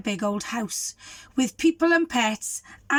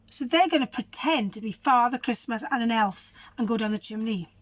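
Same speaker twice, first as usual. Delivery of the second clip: usual speaking voice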